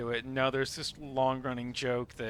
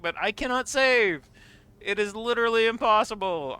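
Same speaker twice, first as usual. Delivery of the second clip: high, raised theatrical complaint; mock protest; 8 semitones higher, 4 dB louder than usual. Streamer answering a viewer's save redeem, high mock protest. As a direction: protest loudly, as if helpless.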